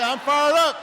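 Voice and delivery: high, loud answer; shouted reply. Retold or imitated. Staff shout their loud reply back to the candidate. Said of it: "And they say".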